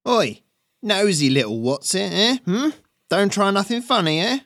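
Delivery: high-pitched, loud thug voice; menacing raider. Acted. Bandit with crossbow threatens a hidden onlooker, high menacing voice. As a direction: taunt them in a high, jeering character voice.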